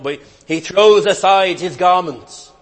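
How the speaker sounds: a preacher's sudden swell; preaching surge. Acted. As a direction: lift the line with fervour.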